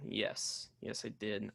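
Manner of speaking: very soft, low, under the breath; talking to oneself. Real, not acted. Host checking last week's pick, low murmur to self. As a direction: quietly confirm it to yourself.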